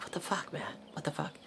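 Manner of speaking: hushed, high, whispery bafflement; retold puzzled self-talk. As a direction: whisper it to yourself, mystified. Retold muttering to himself, puzzled.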